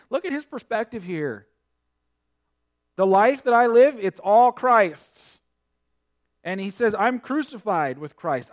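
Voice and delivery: loud, rising sermon delivery; preaching. Acted. Preacher proclaiming scripture to the church, full force. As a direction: preach it out to the congregation.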